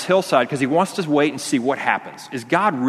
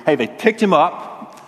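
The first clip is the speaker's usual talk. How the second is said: dramatic storytelling; 7 semitones higher than usual. Retold or imitated. Dramatic read to a child.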